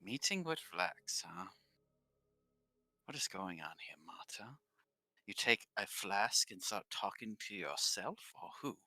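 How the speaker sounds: soft, probing character voice; suspicious questioner. Acted. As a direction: question quietly and knowingly.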